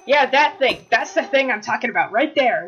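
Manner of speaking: loud, insistent call; excited pointing out. Real, not acted. Player spotting the puzzling effect, excited shout.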